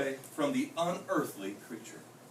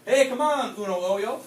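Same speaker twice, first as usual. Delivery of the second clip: calling his friend, a little louder; kitty boy calling his friend, raised call; 4 semitones higher, 6 dB louder than usual. A narrator's reading. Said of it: "called the kitty boy".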